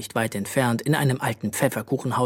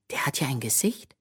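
astonished whisper; amazed; 6 dB quieter than usual. A narrator's reading. Bö discovering the hat's face, amazed whisper. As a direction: breathe it out in wonder. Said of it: "stellte Bö verblüfft fest".

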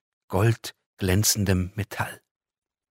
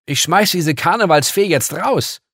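much higher, loud indignant threat; outraged; 10 semitones higher, 9 dB louder than usual. Acted. Girl threatening to throw the angel out, outraged. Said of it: "empörte sie sich".

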